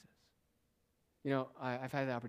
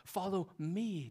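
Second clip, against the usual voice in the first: "me" drawn out higher and slow; slow, raised "Follow me"; stress on me; 6 semitones higher, 4 dB louder, much slower than usual. Shown as a demonstration. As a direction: emphasise the pronoun "me". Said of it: "Again, the emphasis on the pronouns".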